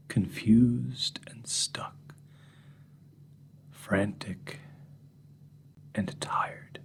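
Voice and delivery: drawn-out whispered words; very slow soft listing. Acted. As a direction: whisper each word slowly, hollow.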